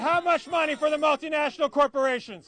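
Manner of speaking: shouted call for a crowd's response; rally shout. Real, not acted. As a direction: fire the question out to rouse a crowd.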